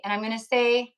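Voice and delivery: ordinary talk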